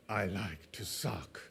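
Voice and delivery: slow, low, soft sultry purr; soft smoky woman's voice. Pretend. Mock ad woman on the lips close-up, sultry. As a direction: breathe it like a film-noir smoker. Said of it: "soft, smoky woman's voice".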